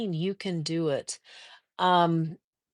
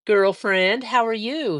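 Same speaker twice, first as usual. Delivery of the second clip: excited greeting; 4 semitones higher, 3 dB louder than usual. Real, not acted. Greeting a viewer who joined.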